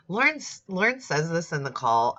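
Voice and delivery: usual speaking voice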